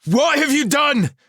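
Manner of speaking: high, loud distraught cry; anguished cry. A narrator's reading. Elan cries out at Merlin, loud and anguished. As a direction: cry out in shock. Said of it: "Elan cried".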